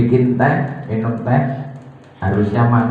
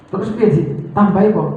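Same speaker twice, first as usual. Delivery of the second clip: high, louder eager offer; anxious wife; 9 semitones higher, 3 dB louder, faster than usual. Retold or imitated. Wife asking her husband if she should add more, high and eager. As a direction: rush to fix the tea.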